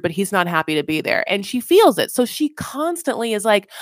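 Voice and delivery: ordinary talk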